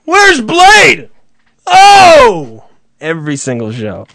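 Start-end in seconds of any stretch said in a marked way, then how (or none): 0.1-1.1 much higher, louder Where's Blade; hammed-up announcer. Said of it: "play it, and then say". 1.7-2.6 high, loud hammed-up announcer; radio host mocking the recurring question, high and loud; announcer intro. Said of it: "play it, and then say".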